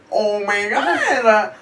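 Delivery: high, slow dread; high drawn-out oh my God; mock panic. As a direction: gasp it like doom is coming. Fearing getting jumped.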